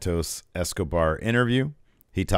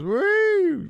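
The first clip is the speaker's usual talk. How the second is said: woo; 4 dB louder than usual. Real, not acted. Excited wrestling podcast hype.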